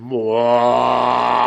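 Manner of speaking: very drawn-out ghostly howl; imitated ghost wail. Man repeats the sound the ghost made, imitated long wail. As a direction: stretch out one long wailing moan. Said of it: "And he goes, "It went".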